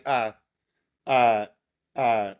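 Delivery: ordinary talk, nothing marked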